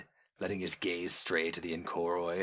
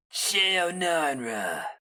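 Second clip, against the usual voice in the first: high, very slow inhuman drawl of a name; inhuman creature voice; 10 semitones higher, much slower than usual. A narrator's reading. Orang the Inkoroi, tired, calling Sheo Narnra in a slow inhuman voice. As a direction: stretch the name out like a monster. Orang speaks slowly after silence.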